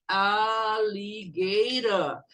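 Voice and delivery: "Alligator" stretched very slowly, syllable by syllable; slow-motion "Alligator" for learners; drawn-out model. Shown as a demonstration. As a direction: draw out every vowel of "Alligator". Teacher models word syllable by syllable.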